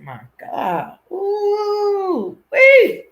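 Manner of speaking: a gleeful squeal that climbs up into a wee; mock squeal. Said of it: "I said".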